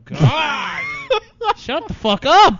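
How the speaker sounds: slow, high laughing shout. Real, not acted. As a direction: yell it through laughter. Laughing outburst at friends.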